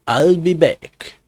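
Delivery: slow, drawn-out Austrian movie-robot line; mock Austrian tough-guy accent. Narrator doing an Austrian accent at his phone. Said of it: "I say with my best Austrian accent".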